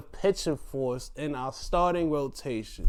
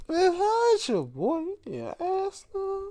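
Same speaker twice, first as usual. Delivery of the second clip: mock teasing squeal. Teasing a wrestler's new curves.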